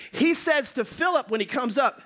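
ordinary delivery

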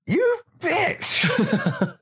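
high-pitched mock outrage at a rival; mock outraged insult. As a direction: spit it out in exaggerated, high indignation. Reacting to the corporate lady.

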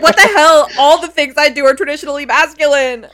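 indignant. Retold or imitated. Kate snaps back at Jack, mock indignant and loud. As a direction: throw the protest back at him. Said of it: "And Kate's like".

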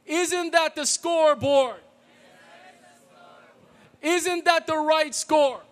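cheerleader call. Shown as a demonstration. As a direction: call it out for the room to answer. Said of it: "I'll be the cheerleader and you guys respond".

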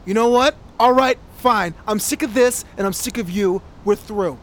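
put-on loud, furious blow-up at his girlfriend; angry outburst. Boyfriend yelling at his girlfriend that he's done, furious. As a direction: let it all explode at her. Bruce finally snaps at Abby.

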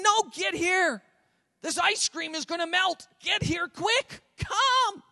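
high, slow, stretched urgent call; pleading, insistent high-pitched summons; emphatic parent. Acted. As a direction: call the child in, pitch climbing on each command. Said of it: "there's an emphasis".

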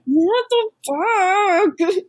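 high, long drawn-out disbelief; mock incredulity. Retelling her answer to him about the barbecue, mock incredulous. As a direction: stretch each word in hammed-up dismay.

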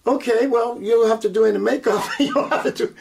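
quoting her between real laughs; can't hold back laughter while quoting; laughing through the words. Real, not acted. Laughs while retelling makeup remark.

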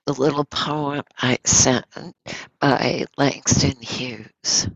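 ordinary talk, nothing marked

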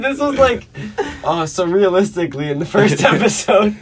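laughing. Real, not acted. Friend laughs while teasing Nick about repeating himself. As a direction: tease him while cracking up.

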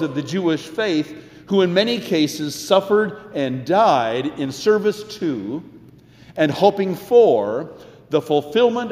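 earnest, rising preacher's cadence; sermon delivery. Acted. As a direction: preach it to the congregation.

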